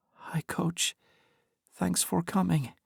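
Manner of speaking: faint, breathless whisper from a hospital bed; weak whisper. A narrator's reading. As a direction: barely whisper it, weak and tired. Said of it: "her voice quiet".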